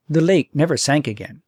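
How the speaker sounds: ordinary delivery, nothing marked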